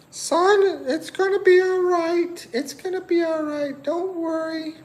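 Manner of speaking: high falsetto mom comforting her son; high falsetto mom comforting her heartbroken son; mom's reply voice. Pretend. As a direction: soothe him like a doting mom, way up high. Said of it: "Mom, I'm heartbroken".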